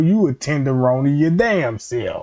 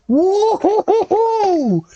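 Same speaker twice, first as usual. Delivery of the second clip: very high, drawn-out falsetto whoop; tipsy whoop; much slower than usual. Acted. Boy who drank some and feels tipsy, high silly whooping. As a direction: let out a long hooting woo-hoo. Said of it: "he feel tipsy".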